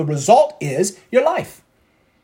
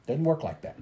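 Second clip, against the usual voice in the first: soft "Doesn't work like that."; quiet verdict; 2 semitones lower, 10 dB quieter than usual. Acted. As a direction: settle it softly.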